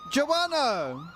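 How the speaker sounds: high, bright shout of the name; excited call. Real, not acted. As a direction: call her name out with joy. Calling out donor name excitedly.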